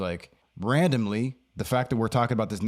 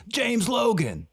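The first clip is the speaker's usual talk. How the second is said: high, slow, proud introduction; Stone Man introducing; 12 semitones higher, slower than usual. Retold or imitated. Stone Man introducing him to his friend, high and slow. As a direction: present the name with big ceremony.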